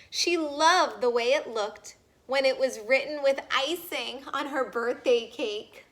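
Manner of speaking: usual speaking voice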